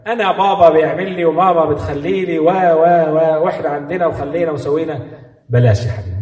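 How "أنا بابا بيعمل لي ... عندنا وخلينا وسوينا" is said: put-on high-pitched bragging kid; boastful child. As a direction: show off about what your parents give you. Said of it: "الفخور بكلامه".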